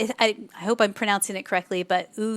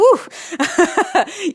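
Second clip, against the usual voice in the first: lightheaded woo; 9 semitones higher, 6 dB louder than usual. Retold or imitated. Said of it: "you start to feel a little bit like".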